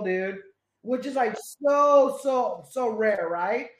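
ordinary talk

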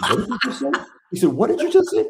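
high, loud shocked disbelief. Retold or imitated. Client shocked at therapist.

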